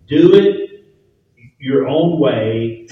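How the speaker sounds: loud, drawn-out mocking of Cain's attitude; Cain's defiant motto. Preacher voicing Cain's defiant slogan. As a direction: spit out Cain's stubborn creed slowly.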